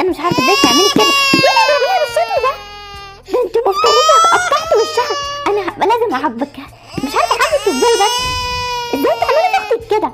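fast, high, crying reproach that breaks into shouts; tearful scolding. Acted. Mother scolding the daughter who hurt her sister, crying and shouting. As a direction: scold her through tears, voice cracking.

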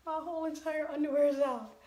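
gasping, high and quick; surprised gasp. Real, not acted. Trying on clothes, startled by a wardrobe slip, surprised. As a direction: blurt it out in shock.

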